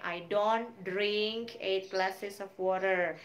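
slow, careful negative example; slow model sentence. Shown as a demonstration. Teacher modelling a negative sentence, slow. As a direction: lay out each word slowly. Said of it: "kalimatnya sama tetapi negatif".

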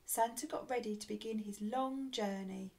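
ordinary delivery, nothing marked

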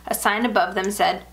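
ordinary delivery, nothing marked

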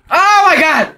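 high, loud shriek of surprise; scared yelp; startled scream. Real, not acted. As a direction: jump out of your skin. Sudden scare in game.

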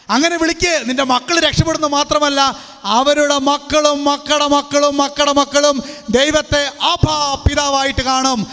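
preaching. Acted. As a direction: preach it to the congregation. Preacher exhorting congregation. (പ്രസംഗ ശൈലി)

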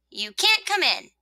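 high-pitched refusal; refusing rosebud. Acted. Rosebud turning the sun away, refusing.

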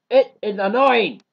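loud, high burst of irritation; annoyed outburst. Real, not acted. Wrapping up the cheeseburger rant to viewers, annoyed outburst. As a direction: fling the line out in real annoyance.

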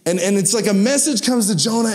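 usual speaking voice

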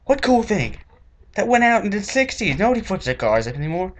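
high, indignant scolding; mock them in disbelief; outraged high-pitched comeback; incredulous rant. Ranting back at teens.

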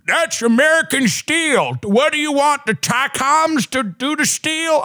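high, slow indignant commenter voice; defensive commenter. Retold or imitated. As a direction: scold him like an offended local. Commenters defending Cleveland steel.

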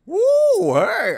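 very high loud whoop; shrill surprised hey; startled yelp. Real, not acted. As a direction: yelp from a fright. Sudden jolt.

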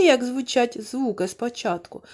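ordinary delivery

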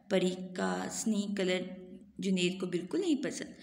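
ordinary delivery

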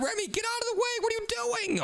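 panicked high cry of protest; high frantic yell. Real, not acted. Brother yelling at Remy blocking his game, high frantic. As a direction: yelp at her to move.

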